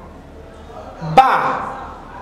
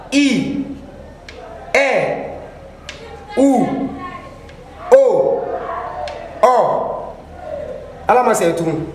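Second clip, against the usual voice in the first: vowels sung out higher for the class to echo; call-and-repeat recitation; 4 semitones higher, 4 dB quieter, much slower than usual. Shown as a demonstration. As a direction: lead the class through the vowels, one at a time. (voyelles scandées pour que la classe répète)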